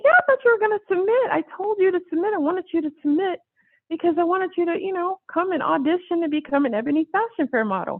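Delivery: high, fast eager pushing; insistent Steve. Retold or imitated. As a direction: push her to submit, rushing.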